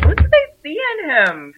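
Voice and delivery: very high scornful mock voice; mocking Thessaly. Retelling Thessaly's scorn, a high mocking voice. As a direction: sneer it in a high put-on voice. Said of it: "She's like".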